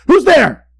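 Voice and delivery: put-on high, startled old man's cry; frightened cry. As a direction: cry out in sudden fear. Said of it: "sat straight up in bed and cried".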